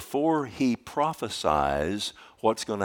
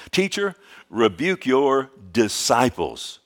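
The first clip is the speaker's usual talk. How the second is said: louder, higher, slow call from the crowd; hammed-up Pharisee demand, louder and slower; mock Pharisee call; 4 semitones higher, 6 dB louder, slower than usual.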